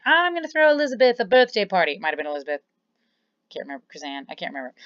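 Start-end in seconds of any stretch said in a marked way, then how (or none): 0.0-1.9 acting out a scene. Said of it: "where I was like".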